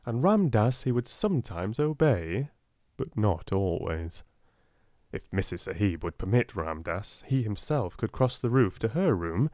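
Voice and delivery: plain reading voice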